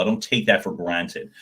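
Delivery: ordinary talk, nothing marked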